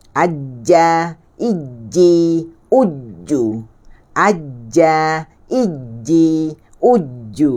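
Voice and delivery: "Aja", "Iji", "Uju" with a held j; doubled jim drill. Shown as a demonstration. Teacher drills jim, held j in "Aja. Iji. Uju". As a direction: hold the j, then lengthen the final vowel.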